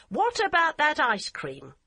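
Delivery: brightly changing the subject; hearty. A narrator's reading. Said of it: "Barbara asked heartily".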